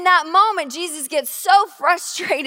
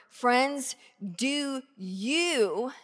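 normal talking voice

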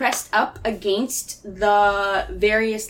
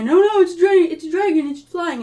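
alarmed shout; 8 semitones higher, 6 dB louder, faster than usual. Acted.

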